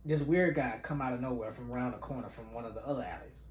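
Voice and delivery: normal talking voice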